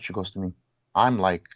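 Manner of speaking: usual speaking voice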